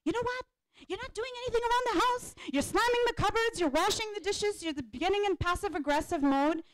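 high, fast accusing rant; wife attacking husband over housework, shrill and fast; attacking nagging. Shown as a demonstration. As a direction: lash out at him in exasperation. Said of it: "sometimes we tend to attack".

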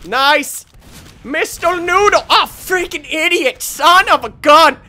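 loud, high gamer outburst; high-pitched shouted exclamations; worked-up yelling. Real, not acted. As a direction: yell names at the opponent. Kill, then dying in game.

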